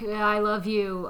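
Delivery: slow, deliberate mock sincerity; slow, spelled-out "I love you". Podcast host mocking a cheap dinner, slow and deliberate. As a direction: draw it out like a sweet card. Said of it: "that's like".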